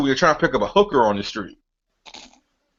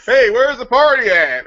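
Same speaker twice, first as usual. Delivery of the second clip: slow, higher dated party-guy call; mock party-seeker; 7 semitones higher, 5 dB louder than usual. Friend mocks the guys' line, corny old-school call. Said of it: "house party from nineteen eighty-nine".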